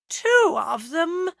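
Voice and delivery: slow groaning exclamation. A narrator's reading. Agatha seeing the second cat, groaning. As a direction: groan it out in dismay. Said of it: "groaned Agatha".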